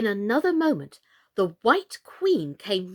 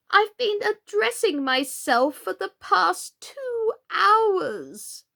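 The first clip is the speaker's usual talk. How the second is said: much higher, louder, slow whining queen; 12 semitones higher, 6 dB louder, much slower than usual. A narrator's reading. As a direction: drag out the complaint in a high queenly wail.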